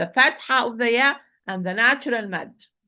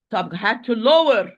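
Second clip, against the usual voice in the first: ordinary delivery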